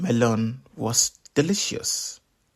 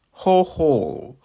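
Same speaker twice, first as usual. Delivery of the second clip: sly chuckle; 4 semitones higher, 5 dB louder than usual. Acted. Anansi chuckles at the melon.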